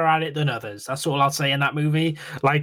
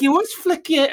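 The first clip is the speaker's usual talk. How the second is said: bickering sister; 14 semitones higher than usual. Retold or imitated.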